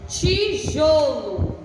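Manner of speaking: tijolo drawn out slowly and called out; slow called-out word. Shown as a demonstration. Teacher to young pupils, calling out the word slowly. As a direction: call out tijolo slowly so kids can copy. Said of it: "teacher calls out word for kids".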